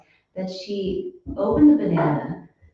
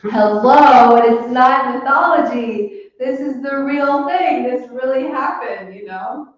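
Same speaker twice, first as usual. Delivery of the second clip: loud, higher mock indignation; indignant retort; 6 semitones higher, 9 dB louder than usual. Answering the article, loud and indignant. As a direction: scoff back at the article. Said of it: "And I'm like".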